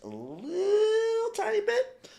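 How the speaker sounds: high, slow, squeaky little worried voice; high mock worry. Real, not acted.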